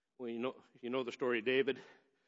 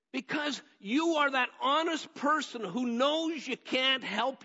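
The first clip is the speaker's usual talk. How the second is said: high, loud, slow preaching; preaching at full force; 10 semitones higher, 5 dB louder, slower than usual. Acted. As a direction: preach it to the congregation.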